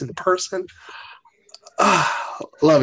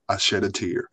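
low, soft, emotionless imitation; C J's monotone; 8 semitones lower, 6 dB quieter than usual. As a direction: report crying like a robot. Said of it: "he just monotone through life".

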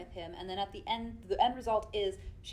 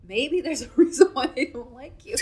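laughing while saying it; 5 semitones higher, 11 dB louder than usual. Real, not acted. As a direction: giggle through the words. Laughing through a blunt remark.

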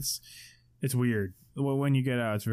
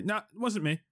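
panicked denial; 9 semitones higher than usual. Retold or imitated. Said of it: "get out and say".

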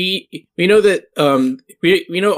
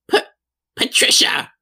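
much higher, stammering, wounded outcry; aghast hag Karen; 11 semitones higher than usual. Acted. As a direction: recoil in melodramatic hurt at a friend's betrayal. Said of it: "she's like".

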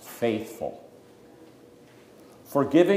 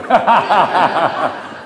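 loud, high burst of laughter; real hearty laughing, one long peal; 6 semitones higher, 9 dB louder than usual. Real, not acted. Teacher reacts to "oh, you knew", laughing out loud.

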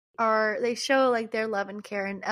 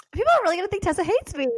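high, fast fretting; mock dismay; 8 semitones higher, 4 dB louder, much faster than usual. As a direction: fret in a high, hurried voice. Acts out own dismay at post.

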